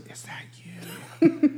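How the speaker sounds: high, slow, breathless whisper; a clerk's hushed aside; whispered question. Retold or imitated. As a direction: lean in and whisper it.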